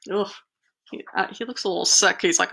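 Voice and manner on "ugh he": usual speaking voice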